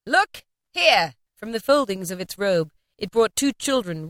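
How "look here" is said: sudden high call; loud call. A narrator's reading. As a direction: cry out to draw the eye. Spirit shows the children.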